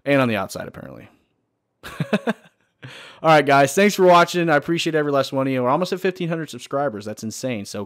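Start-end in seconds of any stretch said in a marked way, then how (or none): 1.8-2.3 short high chuckle; brief, high-pitched giggle; laughing. Real, not acted. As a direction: let a little laugh out. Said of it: "I'm laughing a little bit on the".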